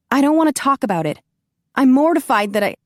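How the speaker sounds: embarrassed protest to Grigori; flustered. Acted. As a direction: rush to stop him talking. Heroine embarrassed, cutting him off.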